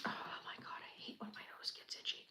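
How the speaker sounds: fast whispered grumble; whispered complaint. Real, not acted. Builder whispers a gripe about an itchy nose. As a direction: mutter it under your breath.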